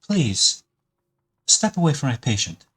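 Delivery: high, firm polite request; insist, keeping it courteous; firm doctor. Acted. Doctor orders stranger away from the sick man, firm and high. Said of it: "looks up at you and says".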